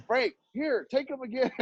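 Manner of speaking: high-pitched mock plea from a parent; pleading parent. Parents trying to drop kids off, high mock plea.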